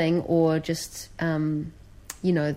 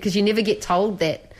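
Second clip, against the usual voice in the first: ordinary talk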